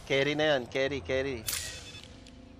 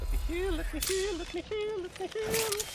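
rising higher and higher, pleading; panicked pleading; 6 dB quieter than usual. Real, not acted. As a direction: beg for time to heal, pitch climbing. Pleading while under fire.

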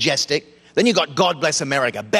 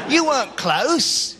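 slow and high, spelling it out; slow emphatic correction; 7 semitones higher, slower than usual. Acted. Correcting the Texan slowly and deliberately. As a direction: drag it out to make the point.

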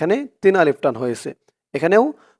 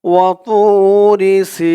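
slow melodic tajweed chant, a bit higher; Quran recitation; 3 semitones higher, 4 dB louder, much slower than usual. Shown as a demonstration. Teacher reciting for viewers to repeat, slow tajweed chant. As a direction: recite it slowly so students can follow. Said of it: "recitation demo".

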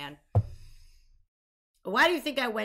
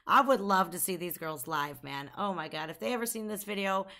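usual speaking voice

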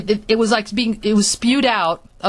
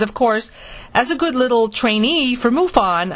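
ordinary talk

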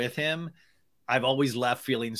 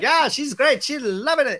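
much higher, louder bad impression; horrible Brock voice; 12 semitones higher, 5 dB louder than usual. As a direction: do a hammed-up cheerleading boyfriend. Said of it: "that's my horrible Brock voice".

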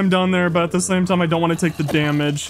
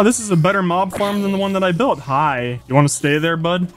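ordinary delivery

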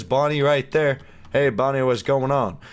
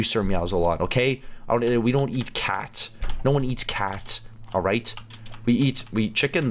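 usual speaking voice

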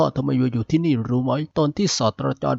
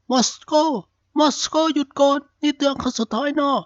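shouted, much higher, as a final warning; angry warning shout; 12 semitones higher than usual. Acted. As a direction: bark a last warning, much higher and sharp. Berlin warns Moscow at gunpoint.

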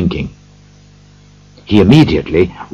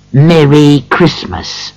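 slow, higher-pitched mocking echo; scornful quoting; 6 semitones higher, slower than usual. A narrator's reading. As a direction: throw the greeting back sourly, drawn out. Scrooge scornfully quotes the greeting.

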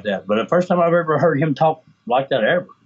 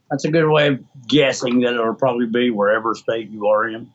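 normal talking voice

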